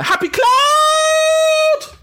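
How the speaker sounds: very high, slow drawn-out shout; excited shout. Real, not acted. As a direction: call the name out with joy. Greeting a viewer loudly.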